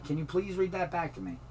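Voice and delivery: ordinary talk, nothing marked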